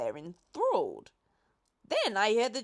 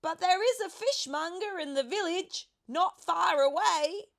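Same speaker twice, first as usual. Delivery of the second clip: high, louder call upward; 11 semitones higher, 4 dB louder than usual. A narrator's reading. Boy calling up to the pelican, high and loud. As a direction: keep calling up to him. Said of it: "I called back to him".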